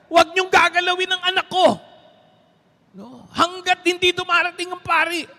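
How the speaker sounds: furious high shout of a grieving father; raging father. Retold or imitated. Father raging at the embalmers, a loud high furious shout. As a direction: shout it like a father who won't let them touch his daughter. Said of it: "Nagwawala yung tatay".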